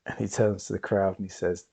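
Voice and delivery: ordinary delivery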